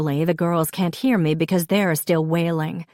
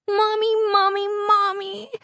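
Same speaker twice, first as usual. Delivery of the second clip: moaning little Charlotte. A narrator's reading. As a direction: moan for mom in a tiny squeal. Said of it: "Charlotte moans".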